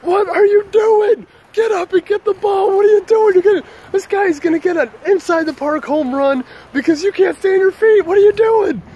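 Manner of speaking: very high, loud mock shouting; over-the-top high-pitched berating; imitated heckling yell. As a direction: holler at a fumbling teammate from third base. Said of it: "because there I am going".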